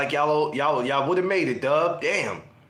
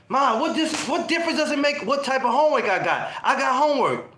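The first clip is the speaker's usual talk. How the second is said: put-on teen attitude; 7 semitones higher than usual. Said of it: "attitude with your mother".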